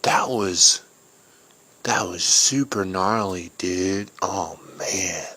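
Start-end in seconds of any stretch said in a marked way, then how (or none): none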